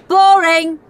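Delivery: loud, high, rude heckle; shouting. A narrator's reading. Henry heckling Claire's pi recital, shouting. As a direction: yell it out like a bored boy. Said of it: "shouted Horrid Henry".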